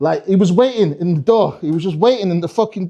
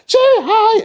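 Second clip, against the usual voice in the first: very high, piercing elf chirp; hammed-up squealed greeting; over-keen drama student; 4 dB louder, slower than usual. Drama students greeting as elves every day, over-chirpy. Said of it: "fucking drama students".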